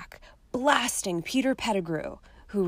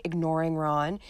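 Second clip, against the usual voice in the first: ordinary delivery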